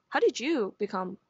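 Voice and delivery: usual speaking voice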